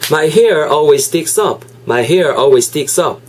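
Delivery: slow, careful teacher's model read; slow model sentence. Shown as a demonstration. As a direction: say each word slowly and clearly. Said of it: "합치면은요".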